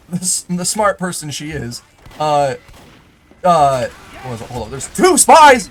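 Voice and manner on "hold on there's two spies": sudden, higher, louder call-out; startled gamer shout; alarmed shout. Real, not acted. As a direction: yell a warning to the team. Spotting enemies mid-game, alarmed.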